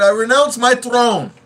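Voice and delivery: high, loud, slow royal proclamation; hammed-up regal quote; movie line impression. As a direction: declare it grandly like a prince. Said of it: "he said".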